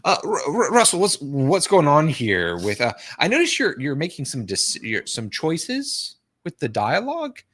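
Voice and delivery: high-pitched, stammering, tiptoeing director; nervous director. Acted. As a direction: hesitate and stretch it out high, raising an awkward concern. Acting nervous director confronting Russell Crowe.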